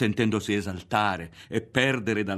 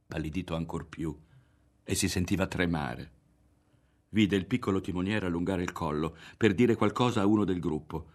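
usual speaking voice

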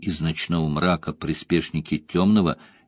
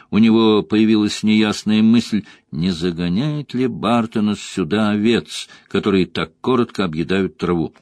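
ordinary delivery, nothing marked

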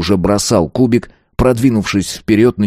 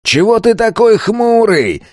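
high, drawn-out heckling yell; yelling bandit; 14 semitones higher, slower than usual. A narrator's reading. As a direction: holler across the stadium. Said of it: "заорал на него тот бандит". (высоко и протяжно заорать)

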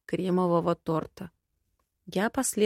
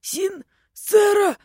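high, slow strangled call; creaky, straining plea for help; creaking, choking cry; 13 semitones higher, 7 dB louder, much slower than usual. A narrator's reading. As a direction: squeeze the name out as if choking. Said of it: "скрипел он".

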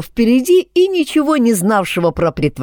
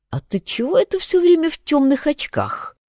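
suspicious; 10 semitones higher than usual. A narrator's reading. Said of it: "искоса глянул на Майкла Шатун".

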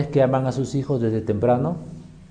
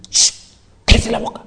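voiceless, forceful mock shush; retold stern hiss to silence a child; shush, then an intense whispered order; hushing command.

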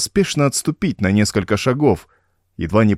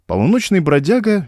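plain reading voice

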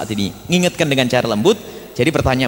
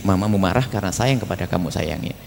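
low, hushed reassurance; soft confiding; 8 semitones lower, 6 dB quieter than usual. Acted. Father explaining mother's anger to the child, low and soft. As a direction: explain softly, as if into the ear. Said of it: "tapi membisiki".

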